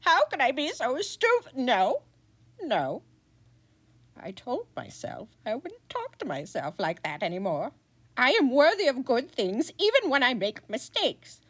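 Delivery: high, fretful wizard muttering to himself; a wizard berating himself, then talking himself down; self-scolding wizard voice; flustered wizard. Acted.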